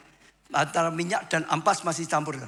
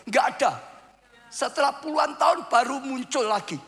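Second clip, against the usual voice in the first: full-force preaching; 9 semitones higher than usual. Acted.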